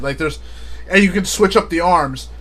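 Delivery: ordinary talk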